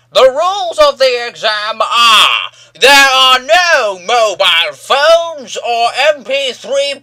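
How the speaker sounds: hammed-up examiner reciting rules; mocking old hag examiner reading rules. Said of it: "this old hag".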